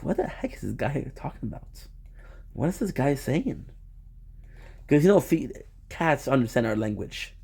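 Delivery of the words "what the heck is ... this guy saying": softly voiced baffled cat; quietly puzzled, as a cat's private thought; confused cat. Cat wondering what this guy is saying, hushed confusion.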